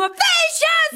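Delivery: sehr hoch, laut, gedehnt kreischend; hysterischer Aufschrei. Acted. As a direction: schrill hinausschreien. Hysterischer Ausbruch. (hoher, gedehnter Schrei)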